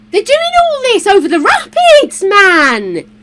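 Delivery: high, loud amazed outburst; amazed exclaim. Retold or imitated. Retelling watching canoe slalom, high amazed outburst. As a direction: marvel out loud. Retold reaction to kayaking.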